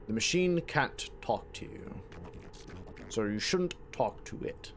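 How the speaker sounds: a hint of a British narrator; British accent. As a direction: read it as a lightly British, hushed warning. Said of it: "kinda like a British accent".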